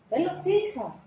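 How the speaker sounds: put-on high, loud little boy; urgent little boy; child voice. Child calls for potty. (ψηλή παιδική φωνή)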